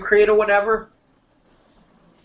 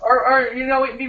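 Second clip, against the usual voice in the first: usual speaking voice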